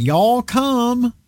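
put-on Y'all come in a thick southern accent, pitched high; heavy southern accent. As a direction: call out Y'all come like a southern host at an open door. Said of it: "a heavy southern accent".

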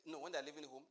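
ordinary delivery

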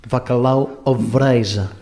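slow, drawn-out fake Portuguese accent; mock Portuguese accent. Host attempting Portuguese accent for the pilot. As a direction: put on a playful Portuguese accent for the dish name. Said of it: "A ver cómo me sale el acento".

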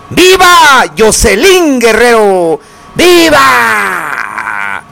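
slow, drawn-out cheers; stretched festive cries, falling at the end; festive viva shouts. Acted. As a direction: call out toasts like a fiesta announcer. Radio host calling out vivas.

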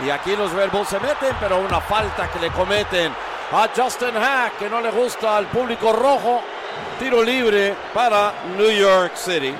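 lifted Spanish match commentator voice; play-by-play call. Real, not acted. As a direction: call the foul with rising energy. Live play-by-play.

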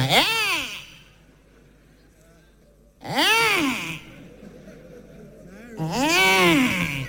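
high, drawn-out mock Tinubu; Tinubu impression. Tinubu phoning the comedian, hammed-up high voice. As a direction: ham up the president's slurred cry.

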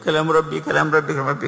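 high-pitched, mildly tearful repeated exclamation; imitated tearful cry. Teacher imitating Ikrima weeping over the Mushaf, tearful and high. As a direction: imitate a man overcome, voice lifted high. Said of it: "على وجهه يبكي، يقول".

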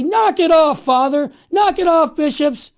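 loud, high, punchy demand; speaking up forcefully. Acted. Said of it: "time to speak up and say".

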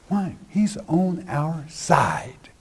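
slow hushed whisper; slow reassuring whisper to the flock. Real, not acted. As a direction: lean in and breathe it like a secret. Confiding promise to congregation.

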